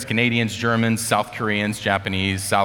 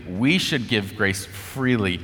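ordinary delivery

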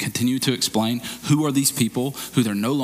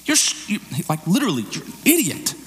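much higher, exasperated scolding; outraged rebuke; 7 semitones higher than usual. Acted. Spirit of grace grabbing us by the collar, outraged exasperated scolding. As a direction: shake sense into a foolish friend. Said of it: "The Spirit of grace is outraged and grabs us by the collar and goes".

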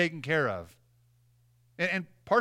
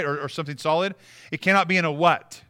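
ordinary delivery

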